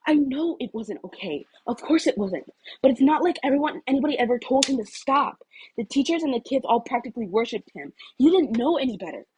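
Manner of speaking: a hint of a defensive boy; lightly voiced Izuku. Izuku defending himself to Ida.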